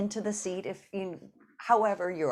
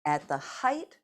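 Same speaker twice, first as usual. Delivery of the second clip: ordinary delivery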